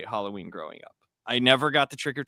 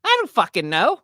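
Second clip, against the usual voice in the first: put-on much higher, louder kid whine; a kid asked a silly question, higher; little kid voice; 10 semitones higher, 6 dB louder than usual. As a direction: blurt it like an exasperated child. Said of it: "He's like".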